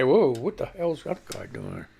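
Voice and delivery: lightly voiced low, slow startled viewer; mild mock alarm from a viewer; a hint of a puzzled listener; imagined listener. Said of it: "you can back away and say".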